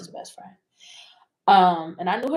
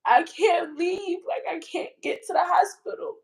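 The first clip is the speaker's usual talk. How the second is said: tearful helplessness; 7 semitones higher than usual. Real, not acted. Woman stuck, unable to reach hospital, tearful helpless voice. Retells being stuck, voice breaking.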